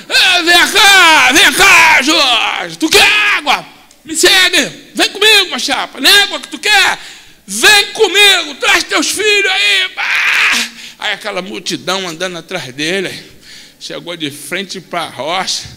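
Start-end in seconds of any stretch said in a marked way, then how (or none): 0.1-10.7 loud, high calling the crowd; rally everyone to follow; shouting to the crowd to come; excited shouting. Acted.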